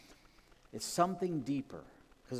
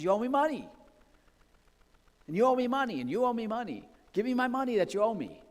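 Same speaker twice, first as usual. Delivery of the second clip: much higher, loud furious shouting; angry demand; 10 semitones higher, 7 dB louder, faster than usual. Acted. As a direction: bark at each debtor in turn. Servant demanding debts back.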